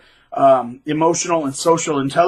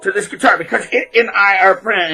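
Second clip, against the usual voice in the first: mock dreamy gushing; 4 semitones higher, 4 dB louder than usual. Guitarist spacing out about his guitar, mock dreamy. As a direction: gush like a spaced-out lover. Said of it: "he'd blank out, space out".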